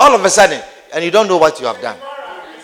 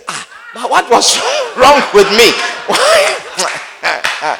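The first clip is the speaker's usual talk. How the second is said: mock distraught wail; 4 semitones higher, much slower than usual. Preacher acting a shopper's regret with a laughing wail. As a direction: wail it out half laughing at yourself.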